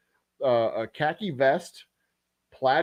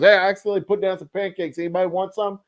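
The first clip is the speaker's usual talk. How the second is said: higher, fast and bright offhand call; the line cook offering spare pancakes, higher and quick; cheery cook; 6 semitones higher, faster than usual. Retold or imitated. The cook calls out across the counter to diners, high and quick. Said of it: "the chef goes".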